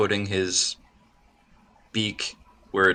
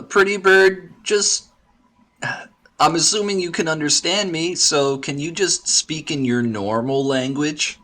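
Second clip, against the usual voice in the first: exasperated plea; 8 semitones higher than usual. Acted. Pleading with the bird.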